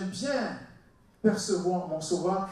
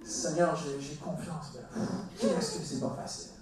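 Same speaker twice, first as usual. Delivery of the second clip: low, soft, hesitant prayer with a puff; falter, then sigh at how hard it is; faltering faith; 5 semitones lower, 6 dB quieter than usual. Acted. Believer praying as circumstances come back, faltering and discouraged. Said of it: "les circonstances te reviennent à l'esprit".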